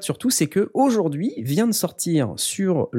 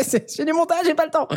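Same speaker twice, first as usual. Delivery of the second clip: high mocking echo; he repeats his excuse to his wife, high; busy me again. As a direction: replay the frantic refusal pitched way up. Repeating his own mock reply.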